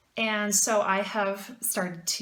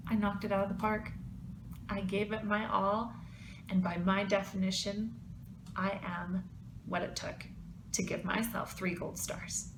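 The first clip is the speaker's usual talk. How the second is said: calm self-affirmation; 7 dB quieter than usual. Pretend. Modeling kind self-talk for viewers.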